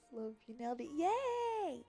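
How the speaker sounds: ordinary talk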